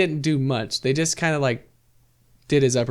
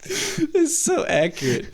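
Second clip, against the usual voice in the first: high, slow, amused; laughing; 13 semitones higher, slower than usual. Real, not acted. Laughing reaction.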